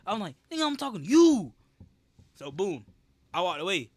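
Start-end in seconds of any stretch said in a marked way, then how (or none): 0.5-1.5 loud, higher-pitched retort swelling on YOU; yelling at him. Retold or imitated. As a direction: re-enact shouting it straight at the coach. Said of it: "I yelled at that bitch".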